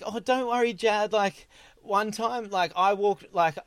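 lightly imitated reassuring girl. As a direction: lightly voice a girl sharing her own embarrassment. Girl consoling embarrassed Jad in retold story.

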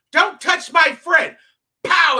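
slow, loud yell; angry shout. Retold or imitated. Woman at the party yelling at the comic, angry shout. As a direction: bark it like a protective friend. Retold woman slapping him.

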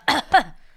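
loud throat-clearing cough; demonstrative cough. Shown as a demonstration. Friend showing how the accent sounds, coughing. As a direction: cough as if shifting phlegm.

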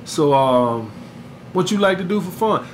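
seductive Mac Daddy mode. Shown as a demonstration. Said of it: "oh, Mac Daddy mode hit on".

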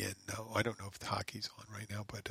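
quick whispered aside. Real, not acted. Aside while talking about sports.

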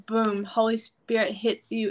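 usual speaking voice

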